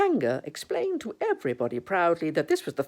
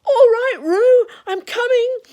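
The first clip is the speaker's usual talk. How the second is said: high, loud rescue shout; calling out; 14 dB louder than usual. Acted. Christopher Robin hurrying to rescue Roo, high urgent call. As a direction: shout reassurance to someone far off. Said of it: "were calling out to the others".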